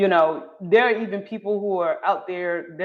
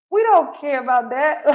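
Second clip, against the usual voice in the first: laughing shout; 7 semitones higher, 4 dB louder than usual. Retold or imitated. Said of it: "'cause I'm like".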